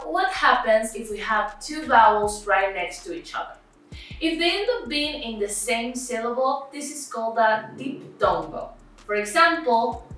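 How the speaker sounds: ordinary talk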